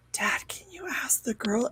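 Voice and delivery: soft unvoiced whisper to dad; whispered request. Little League son asking dad quietly, whispered. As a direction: whisper it shyly to your father.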